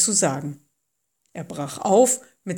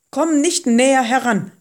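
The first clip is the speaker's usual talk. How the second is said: raised, higher warning call; 5 semitones higher, 6 dB louder than usual. A narrator's reading. Voice from the bush warning Moses off, calling out. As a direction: call out a firm warning to stop. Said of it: "rief die Stimme".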